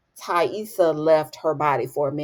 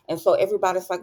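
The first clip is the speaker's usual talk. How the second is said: ordinary talk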